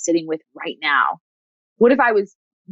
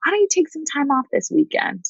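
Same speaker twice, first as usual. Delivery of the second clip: tender grandmother voice; 4 semitones higher than usual. Retold or imitated. Counselor voicing a client's grandmother, tender and caring. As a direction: play a doting grandmother offering rest and a hug. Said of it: "turn the volume up on your grandmother that says".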